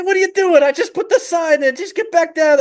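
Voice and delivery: high, put-on irate squawking; mad sheep. Sign-holding sheep mad at Po, high angry scolding. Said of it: "the guy's all mad at Poe".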